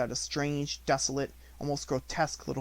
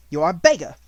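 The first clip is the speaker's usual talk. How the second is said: much louder, scornful sneer; rising temper; 4 semitones higher, 13 dB louder than usual. A narrator's reading. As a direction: spit it out in contempt.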